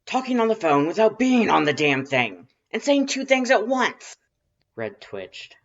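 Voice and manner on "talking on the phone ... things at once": much louder, higher, outraged disbelief; little brother yelling in bewilderment; loud baffled shout. Acted. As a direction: bellow it in confusion.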